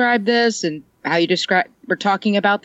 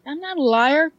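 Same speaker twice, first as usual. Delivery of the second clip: higher-pitched, indignant denial; mock protest; 7 semitones higher than usual. Speaker defends against his accusation, mock-indignant. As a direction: protest it in a raised, retold voice. Acting out own protest to him.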